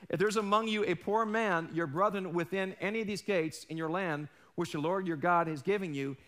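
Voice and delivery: ordinary talk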